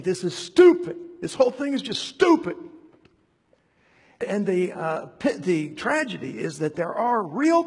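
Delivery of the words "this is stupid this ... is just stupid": loud, higher, scornful; exasperated outburst. Retold or imitated. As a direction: spit out the verdict like it's obvious nonsense. Voicing Jesus dismissing the debate.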